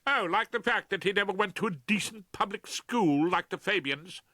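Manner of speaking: high, loud and scornful; sarcastic fury. Acted. Liz mocks the Fabians at Rumpole, scornful. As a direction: sneer at the snobbery. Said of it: "her flow of denunciation".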